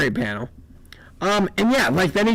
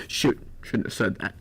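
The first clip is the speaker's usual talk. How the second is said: whispering; 7 semitones lower, 7 dB quieter than usual. Real, not acted. Muttered aside after slip.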